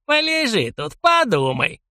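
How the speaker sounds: put-on creaky, high old geezer; very old man. A narrator's reading. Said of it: "проскрипел старик".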